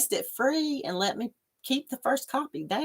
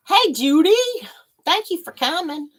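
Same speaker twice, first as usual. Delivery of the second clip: ordinary talk, nothing marked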